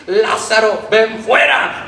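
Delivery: loud call into the tomb; commanding call. Acted. Jesus calling Lazarus from the tomb, commanding. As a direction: summon him out with authority.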